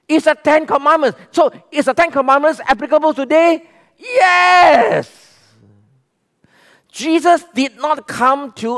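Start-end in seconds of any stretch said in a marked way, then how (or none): 4.0-5.3 a loud high Yes!; emphatic shout. Real, not acted. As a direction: proclaim it to the congregation. Preacher answers his own question.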